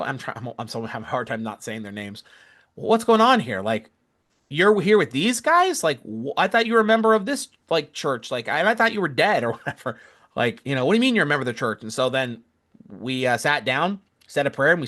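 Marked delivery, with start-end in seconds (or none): 2.8-11.8 higher, louder disbelieving demand, slowing on these guys; imitating her shocked disbelief with a laugh; indignant question. Lady shocked her relative joined the church, mock indignant. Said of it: "she's like".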